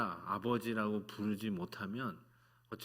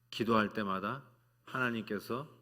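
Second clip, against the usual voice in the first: ordinary talk, nothing marked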